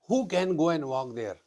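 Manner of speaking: normal talking voice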